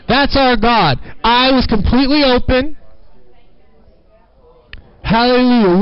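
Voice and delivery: normal talking voice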